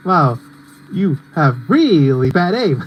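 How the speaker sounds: slow, drawn-out mock put-down; Pinkie Pie. Referencing a YouTube poop, slow mocking imitation. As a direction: stretch "really" in a teasing imitation. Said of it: "that's what Pinkie Pie says".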